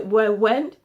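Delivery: ordinary delivery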